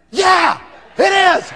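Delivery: high, loud, drawn-out exclamation; very enthusiastic. Retold or imitated. Salesman confirming the product to client, enthusiastic shout. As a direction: shout it with huge enthusiasm, slowly. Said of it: "I'm very enthusiastic".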